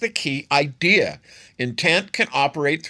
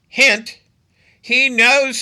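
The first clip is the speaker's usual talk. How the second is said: loud sarcastic hint; 11 semitones higher, 7 dB louder, much slower than usual. Real, not acted. Sarcastic reveal to listeners.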